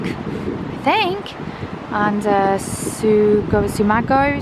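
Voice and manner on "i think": I think said much higher and squeaky; high squeaky repeat. Shown as a demonstration.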